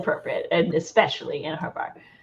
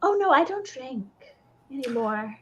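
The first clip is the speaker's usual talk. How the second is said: put-on nervous young woman; 4 semitones higher than usual. Ella refuses drink nervously.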